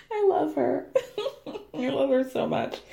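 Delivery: giggling affection; laughing. Real, not acted. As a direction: say it through giggles. Laughing after the joke.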